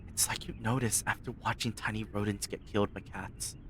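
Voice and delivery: soft, creeping story reading; hushed dark narration. Acted. Reads dark monologue softly.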